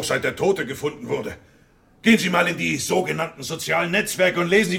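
ordinary talk, nothing marked

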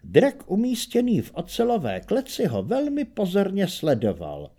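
ordinary delivery

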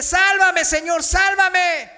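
high, loud shouted plea with drawn-out end; desperate cry for help. Retold or imitated. Falling preacher begs the Lord to save him, desperate shout. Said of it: "gritó desesperadamente".

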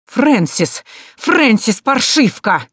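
high, loud scolding yell of the name; shrill shout. Acted. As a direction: screech for the girl in anger. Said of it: "Знакомый противный голос резанул уши". (злобный визгливый крик)

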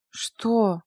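lifeless, toneless question; dead voice. A narrator's reading. Sleepy Mila to Nikolai at her door, dead voice. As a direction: ask it as if drained of all life. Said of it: "мёртвым голосом спросила Мила".

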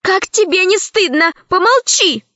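high, scolding tone. Acted. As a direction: scold a child. Alice scolding Lara.

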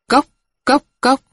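high clipped tok tok tok of a wooden bell; wooden knock imitation. Crier's wooden bell beating, high vocal knocks.

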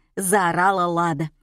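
ordinary delivery

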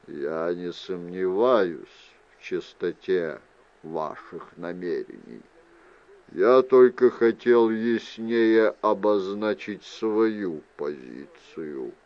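low, slow, soft-spoken gentleman; measured, courteous Mandor. Acted. Mandor clarifies his position to Fiona, low and measured. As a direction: reassure her slowly and quietly, sinking at the end.

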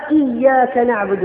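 إياك with a firmly doubled ya, slow. Shown as a demonstration. Preacher reads the Quranic إياك with a stressed doubled ya. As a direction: lean on the shadda in إياك. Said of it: "بالشد على الياء".

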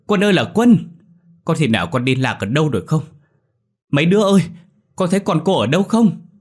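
gọi to cao giọng vì lo sợ; người mẹ gào gọi con; gọi lớn hoảng hốt. A narrator's reading. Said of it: "lên tiếng gọi lớn". (gọi lớn hốt hoảng)

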